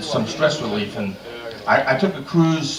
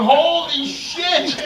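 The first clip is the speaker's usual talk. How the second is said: high, slow, laughing "Holy shit!"; shocked exclaim; 11 semitones higher, much slower than usual. Retold or imitated. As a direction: gasp it out half-laughing in shock. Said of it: "we're going".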